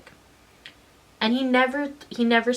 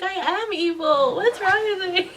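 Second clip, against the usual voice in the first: high-pitched hammed-up wailing; mock wail; 11 semitones higher, 4 dB louder than usual. Storyteller replaying her childhood guilt over bullying, mock wailing. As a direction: wail it out in mock self-reproach.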